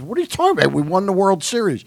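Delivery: a ballplayer who can't believe he's refused; indignant disbelief. Retold or imitated. Billy Martin indignant at restaurant.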